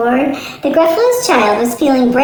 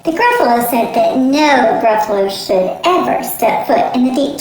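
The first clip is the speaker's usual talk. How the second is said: usual speaking voice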